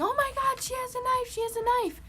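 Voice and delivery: high-pitched mock screaming; yelling and screaming. Boys at the lunch table outing a girl, screaming. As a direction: imitate an alarmed crowd in a high yell. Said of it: "like, yelling and, like, screaming".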